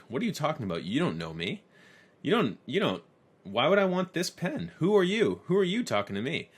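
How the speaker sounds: mock-indignant boss tearing apart a bad pitch; exasperated outburst. Boss Jordan snaps at the new guy's sales pitch, exasperated and loud.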